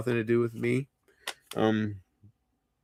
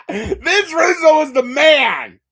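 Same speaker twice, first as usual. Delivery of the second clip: loud, high, drawn-out wail; impassioned outcry; 13 semitones higher, 10 dB louder, slower than usual. Real, not acted. Drunk streamer champions Vince Russo, loud high outcry. Drunken defence of his idol.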